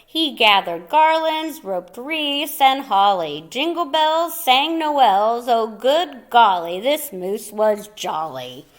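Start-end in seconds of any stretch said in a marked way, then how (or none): none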